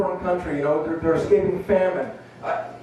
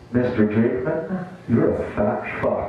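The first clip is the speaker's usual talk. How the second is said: doctor voice; 6 semitones lower than usual. Retold or imitated. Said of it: "what I heard was".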